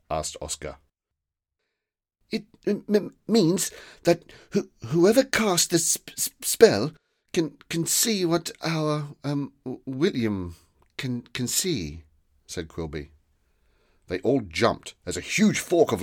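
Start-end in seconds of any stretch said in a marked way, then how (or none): 2.3-12.0 high, halting stammer; stammering, nervous. A narrator's reading. Quilby nervously explains the spell, stammering. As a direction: trip over each word in fear.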